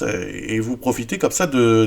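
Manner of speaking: ordinary delivery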